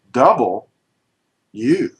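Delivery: "double", long pause, then "U"; split into two words. Shown as a demonstration. Teacher shows a class what the letter's name says, split in two. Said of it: "what do you hear when you say".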